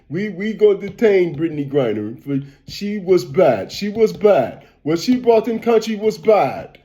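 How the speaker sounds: imitating a stern Russian official, lightly accented; lightly voiced Russian official in a mock Russian accent. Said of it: "You know how they got their little Russian accent".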